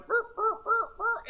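high-pitched repeated burp sounds; playful squeaky mock burps; silly burping noises. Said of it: "you could be sitting up here going".

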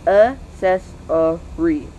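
ACCESSORY in slow, separate syllables. Shown as a demonstration. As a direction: break accessory into evenly spaced syllables. Same word split into syllables.

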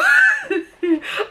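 high far laugh breaking out; sudden real laugh; burst of laughter. Real, not acted. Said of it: "I'm laughing".

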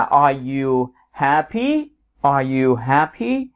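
"happy" lifted at the end, slow and clear; rising question tune. Shown as a demonstration. Teacher models yes-no question rise for students. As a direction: let the voice climb on "happy". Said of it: "mình đá lên ở cuối câu".

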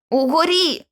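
high, urgent warning; hoarse. A narrator's reading. George warning of danger overhead, hoarse. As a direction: croak out an alarm. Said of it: "хрипко промовив він".

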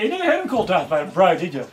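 ordinary delivery

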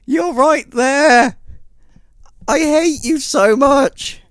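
slow, high, loud whine of outrage; wailing at a near miss; anguished frustration. Real, not acted. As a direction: stretch each word in exasperated fury. Railing at game after near miss.

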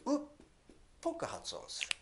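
うっ said as a muffled vowel, tongue on the ridge, then a whispered ぽく発音する; ルではなく「うっ」っぽく; dark L sound. Shown as a demonstration. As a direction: swallow the L like うっ. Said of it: "ルって言わないで".

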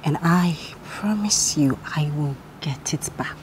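low breathy whispered vow; whispered threat. Acted. Rival vowing to take her man back, whispered. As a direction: promise revenge under the breath. Rival swears revenge quietly.

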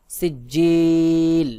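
ringing slow recitation of সিজিল, drawn out to the end; three-alif elongation. Shown as a demonstration. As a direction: sustain the জি of সিজিল firmly, then stop on l. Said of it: "তিন আলিফ টান দিতে হবে".

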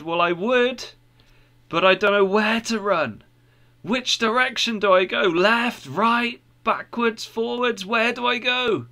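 lightly acted desperate bewilderment; mildly frantic. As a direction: ask urgently which way to go, pitch climbing.

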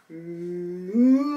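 long, low pretend moo; slow, drawn-out cow call. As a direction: imitate a cow for children. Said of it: "The cow goes".

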